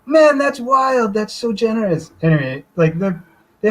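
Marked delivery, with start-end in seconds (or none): 0.0-2.1 put-on high, slow American accent, impressed. Telling how the American server reacted, in a high, slow American accent. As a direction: do an amazed American at the drive-thru. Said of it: "so I'll do the accent".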